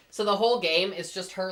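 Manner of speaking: ordinary talk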